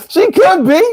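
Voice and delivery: high, squealed words through laughter; helplessly tickled; laughing. Real, not acted. Still laughing at the joke.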